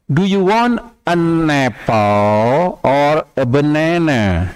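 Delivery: slow, clear English example; slow model sentence. Shown as a demonstration. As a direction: read it slowly for learners. (kalimat contoh pelan dan jelas)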